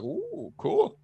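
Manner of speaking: high, soft mock-impressed coo; impressed reaction. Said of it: "it's like".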